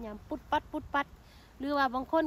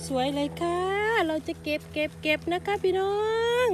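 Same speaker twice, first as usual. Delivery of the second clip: excited; 6 semitones higher, 5 dB louder than usual. Real, not acted. Finds a beautiful mushroom, excited.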